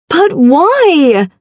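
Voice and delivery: high, whiny and petulant; petulant child. A narrator's reading. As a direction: whine it like a spoilt child. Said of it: "sounded far too much like a petulant child".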